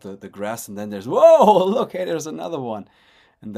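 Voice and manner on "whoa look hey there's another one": high, loud burst of wonder with a laugh; delighted discovery. Retold or imitated. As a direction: gasp out the discovery like a thrilled child. Child-like wonder at nature.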